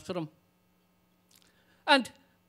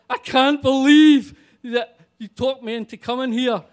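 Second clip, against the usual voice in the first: exasperated outburst; 5 semitones higher, 12 dB louder than usual. Retold or imitated. Exasperated at friend.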